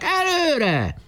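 high rallying cry; rally the others on; shouted. A narrator's reading. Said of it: "kiáltották".

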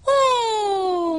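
long, high-pitched oh; swooning collective admiration; awed chorus. A narrator's reading. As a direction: draw out a high sigh of wonder. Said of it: "mondták mindannyian".

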